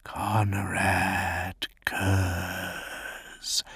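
slow, hushed whisper of the name. Acted. The night haunter whispering his own name, slow whisper. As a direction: breathe the name, drawn out. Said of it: "he whispered".

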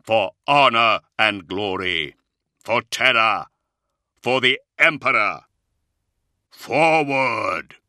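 loud, slow rallying shout; battle cry. Acted. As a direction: call the charge for the Emperor. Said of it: "he cried".

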